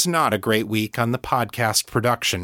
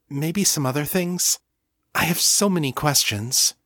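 put-on higher, quick, hopeful young woman; Kashani, shy and eager; 6 semitones higher than usual. Kashani shyly asking John to talk about the book, higher and eager. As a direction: rush the shy request, lifting higher.

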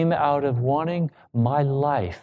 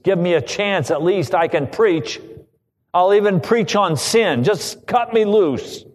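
mad pleading; 8 semitones higher, 4 dB louder than usual. Retold or imitated. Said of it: "and I was mad".

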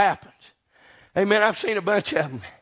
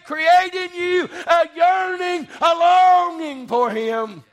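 climactic preaching; 5 semitones higher than usual. Acted.

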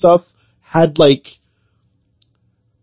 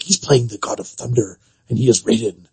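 Raiden voice; 7 semitones lower, 7 dB quieter than usual. Retold or imitated. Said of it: "that's how he talks".

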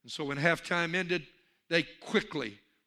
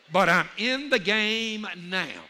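slow, raised and emphatic; rousing declaration; 4 semitones higher, 5 dB louder, slower than usual. Real, not acted. Preacher telling congregation he has joined in, rousing declaration. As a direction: stretch it out with rising conviction.

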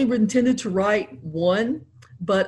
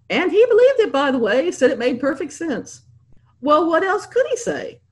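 higher, louder amused aside; excited aside; 8 semitones higher, 3 dB louder than usual. A narrator's reading. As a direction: tell it with delight.

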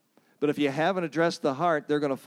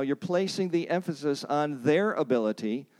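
ordinary delivery

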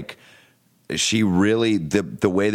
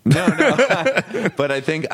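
laughing; 11 semitones higher, 4 dB louder, slower than usual. Real, not acted. Laughs at a compliment.

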